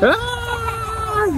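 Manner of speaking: high hammed-up shriek; mock scream. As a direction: shriek as if the car swerves. Pretend wild ride.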